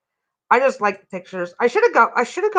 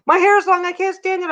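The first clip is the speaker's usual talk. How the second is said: desperate person; 8 semitones higher than usual. Retold or imitated. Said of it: "How many people are gonna get so desperate".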